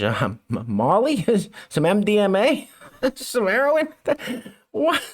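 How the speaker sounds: questions broken up by chuckles, rising in pitch; laughing guesses. Real, not acted. Commentator joking about what the syringe held, laughing. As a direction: laugh between each incredulous guess.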